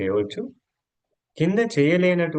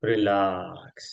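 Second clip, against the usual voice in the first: guided breathing cues; much slower than usual. Acted. Yoga teacher leads breathing in, stretching and relaxing, guided and drawn out. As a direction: draw out the words to pace breath and body.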